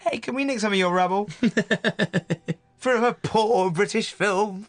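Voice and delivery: mock-needy caller asking a favour; retold cheeky plea. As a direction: put on a lightly pleading voice, drawing out the last phrase. Retold begging call to a producer.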